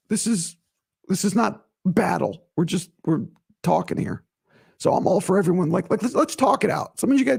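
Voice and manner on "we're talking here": ordinary talk, nothing marked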